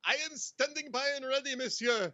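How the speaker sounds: put-on French accent; hammed-up French accent; best French accent. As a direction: answer as a comic Frenchman. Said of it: "put on your best French accent".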